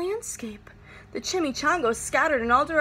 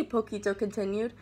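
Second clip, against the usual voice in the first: usual speaking voice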